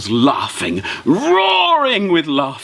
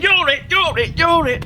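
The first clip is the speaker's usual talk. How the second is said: high, playful tag call; children at play; 10 semitones higher than usual. Acted. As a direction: sing it like a child chasing friends.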